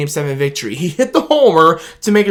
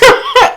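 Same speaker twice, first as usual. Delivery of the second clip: giddy laugh, pitched way up; high burst of laughter; 5 dB louder than usual. Real, not acted. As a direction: laugh out loud with joy. Laugh after shout.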